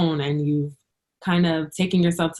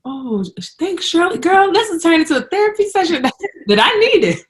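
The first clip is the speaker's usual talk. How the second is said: excited; 10 semitones higher, 5 dB louder than usual. Real, not acted. Grateful, delighted podcast host.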